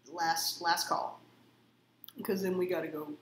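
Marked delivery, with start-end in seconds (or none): 0.1-1.2 slow call out. Pretend. Drawn-out last call.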